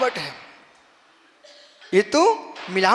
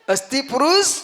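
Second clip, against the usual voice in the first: loud, raised-pitch lead for the audience; loud call; 5 semitones higher, 5 dB louder than usual. Shown as a demonstration. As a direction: call it out strongly for all to echo. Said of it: "जोर से बोलो, जोर से बोलो". (जोर से, ऊँचे स्वर में)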